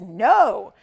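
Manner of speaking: loud, drawn-out NO; emphatic no. Retold or imitated. Auctioneer relays Joyce's refusal, emphatic no. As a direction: stretch NO out loud and slow. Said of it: "A very emphatic no".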